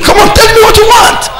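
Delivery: high, urgent shouted demand; impatient shout. Retold or imitated. Preacher voicing God pressing a man for his request, impatient shout. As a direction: shout it as an exasperated order, pitch soaring.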